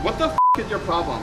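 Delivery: loud furious demand; angry shout. Real, not acted. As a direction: snap at the man filming. Woman confronted by filming journalist.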